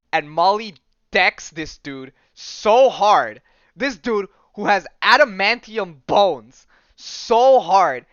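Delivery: loud, slow, hard-hit storytelling; hyped retelling of an epic blow; excited emphasis. Real, not acted. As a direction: stretch the big words and punch them for impact. Relishing a huge comic-book hit.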